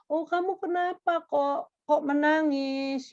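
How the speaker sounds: stretched-out sing-song sympathy, put on; imitated fussing relative. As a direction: coo it high like fussing over a crying child. Imitated relative fussing over crying child.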